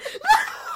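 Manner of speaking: very high exasperated squeal-laugh; high laughing shriek. Acted. Sister reacts to the galling reply, high laughing shriek.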